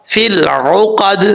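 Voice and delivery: فی العقد drawn out very slowly, ain from the throat; correct recitation. Shown as a demonstration. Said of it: "عین کو حمزہ نہیں پڑھنا". (فی العقد کو بہت آہستہ، عین حلق سے)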